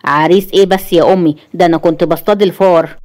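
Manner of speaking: usual speaking voice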